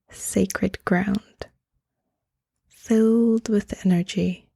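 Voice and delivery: calm meditation voice, leaving space between phrases; guided meditation. Acted. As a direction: gently guide the listener into stillness, phrase by phrase.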